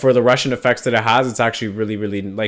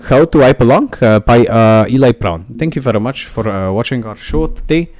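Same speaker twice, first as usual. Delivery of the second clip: slow, louder mock Norwegian announcer; Norwegian accent; 4 dB louder than usual. Host reads the outro songs in a fake Norwegian accent. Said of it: "I'll work on the Norwegian accent".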